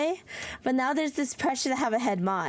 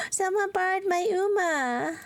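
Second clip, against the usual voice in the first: gasping surprise; 7 semitones higher than usual. Real, not acted. Discovers someone borrowed her Uma.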